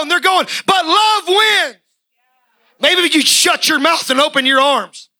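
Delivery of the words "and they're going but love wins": higher, driving sermon voice with shouts; full-force preaching. Acted. Preacher rousing the congregation, high and fervent. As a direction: preach it hard and high to the rafters.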